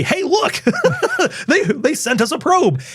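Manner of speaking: very high, giggling alien voice. Pretend. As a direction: squeal it like a giddy alien. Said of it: "my alien voice sounds just like me".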